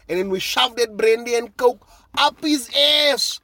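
crowing, high and loud; triumphant gloating. Real, not acted. Fan gloats over the rival coach's defeat, loud and triumphant. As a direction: rise into a loud victory rant.

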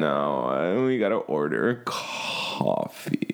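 low, slow creaky media voice; vocal fry. Pretend. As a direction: drag it out in fry. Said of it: "Our media voice?".

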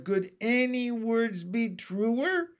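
high, slow, drawn-out delight; cheering cry. A narrator's reading. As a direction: cry it out rising, full of admiration. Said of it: "Miss Spider clapped and cheered and cried".

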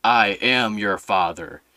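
loom over him, slowly; hammed-up Vader; mock Darth Vader. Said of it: "he goes".

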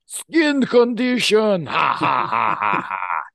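put-on huge, high, drawn-out Russian-accented cry into loud villain laughter; Russian villain outburst. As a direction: throw it back derisively, roaring with Russian-villain ha-ha's. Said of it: "your Russian accent to sizzling oil".

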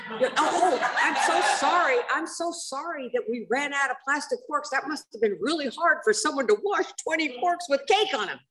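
high, laughing mock sympathy; hammed-up sarcastic sorry to her sponsee; sarcastic apology. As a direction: drip fake sorrow over the forks.

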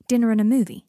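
loud, insistent, stubborn statement. A narrator's reading. Draco insisting to Potter it was a date, stubbornly. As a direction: dig your heels in, loudly. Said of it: "said Draco stubbornly".

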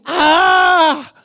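high, open-throated scream; imitated scream. Man whose brace came off, retold high scream. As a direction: cry out in astonished shock. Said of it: "He starts screaming".

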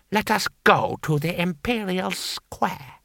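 lightly voiced cold lord, slow and measured; a hint of a smug, scheming noble; Lord Hong. A narrator's reading. Lord Hong speaking to his guards, slow and measured.

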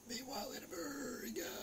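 high, quiet, eerie sing-song; jingle-style intro. Pretend. Vlogger opening his America segment, high eerie sing-song. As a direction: hang the name up high like a spooky theme tune.

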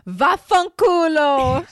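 loud, high, drawn-out curse; shouted Italian swear. Shown as a demonstration. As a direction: belt out the swear word, stretching it long. Performs Italian swear word on request.

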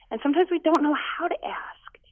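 a tearful, breaking whisper; choked whisper. Real, not acted. Pastor, moved while describing prayer, in a choked whisper. Emotional aside about prayer.

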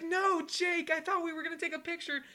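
high, wailing protest; mock dismayed fan. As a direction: plead after a player who is leaving. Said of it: "And the guy's like".

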